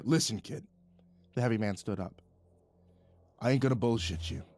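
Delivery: lightly voiced gruff heavyset boss; a hint of a stern, blunt boss. A narrator's reading.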